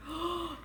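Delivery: soft, sharp gasp; storybook intake of breath; mock shocked gasp. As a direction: gasp in suspense for children. Suspense as grandfather enters cave.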